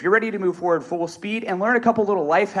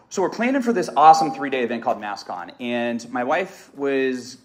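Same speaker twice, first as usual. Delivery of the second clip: usual speaking voice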